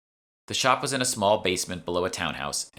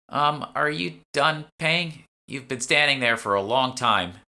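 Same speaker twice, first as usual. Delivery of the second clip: put-on high, loud, slow impatient young woman; impatient young shopper; 9 semitones higher, 5 dB louder, slower than usual. Lisa prodding the narrator to move on, high and impatient. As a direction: drag it out like an annoyed shopper.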